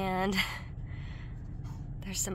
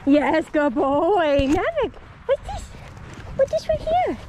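squeaky praising a dog; 6 semitones higher, 7 dB louder than usual. Real, not acted. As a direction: gush over the dog in a squeaky voice. Baby talk to dog.

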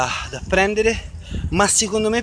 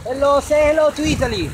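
excited greeting; 11 semitones higher, 4 dB louder than usual. Real, not acted. Greeting to the camera, big shift.

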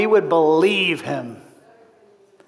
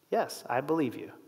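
much softer, quiet surrender; hushed yes; 6 semitones lower, 14 dB quieter than usual. Retold or imitated. Promoter finally answers the tightrope walker, hushed and convinced.

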